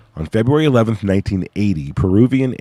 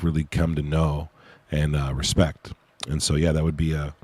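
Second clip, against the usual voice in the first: usual speaking voice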